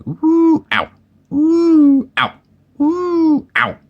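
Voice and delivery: very high long oohs, short ows; bouncing oohs and ows. A narrator's reading. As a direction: alternate high whoops with bumped yelps. Piglet bouncing up and down.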